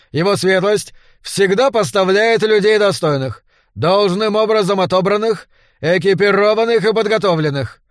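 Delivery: loud barked parade commands; shouting drill sergeant. A narrator's reading. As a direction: bellow orders at recruits. Captain drilling new recruits.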